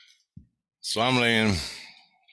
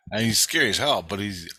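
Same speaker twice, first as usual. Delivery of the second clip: ordinary delivery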